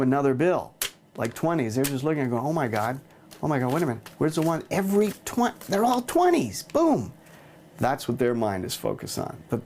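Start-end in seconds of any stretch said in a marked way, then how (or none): 2.4-7.1 amazed spectator. Retold or imitated. Audience member gasping that the bills changed, rising high and amazed. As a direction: play the stunned audience member, pitch rising all the way.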